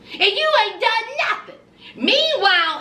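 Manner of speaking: ordinary talk, nothing marked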